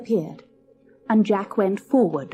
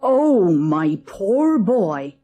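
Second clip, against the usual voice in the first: pitying cry; much slower than usual. A narrator's reading. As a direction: pity the boy, drawn out. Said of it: "cried the woman".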